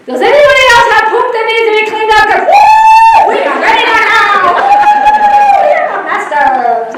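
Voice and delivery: high, loud, fast and gleeful; fired-up announcement. Retold or imitated. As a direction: shout it out like champions.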